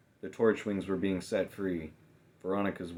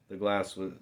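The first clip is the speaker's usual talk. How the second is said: plain reading voice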